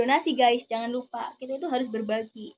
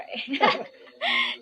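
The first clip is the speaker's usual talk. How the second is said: high laugh; 4 dB louder than usual. Real, not acted. Laughs at own joke.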